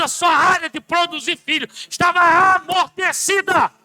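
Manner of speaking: loud, high, passionate sermon; fiery preaching. Acted. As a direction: preach it out to the church. Pastor preaching at full force. (pregação inflamada, alta e aguda)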